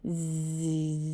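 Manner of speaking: soft, far-off snore; a quiet sleepy snore; snoring. Acted. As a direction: Calvin dozing off, snoring softly. Brother falls asleep.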